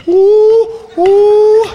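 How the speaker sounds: loud, high monkey hoots; monkey ooh. Acted. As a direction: hoot like an excited monkey. Monkey sound.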